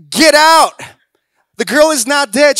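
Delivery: loud, high command to clear the room; commanding shout. Retold or imitated. Jesus ordering the mourners out, commanding shout. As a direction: bark it out with authority.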